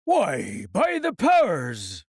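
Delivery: slow, gruff pirate cry; a hearty pirate oath, drawn out; Long John crying out. Acted. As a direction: roll it out like an old sea cook. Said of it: "cried Long John".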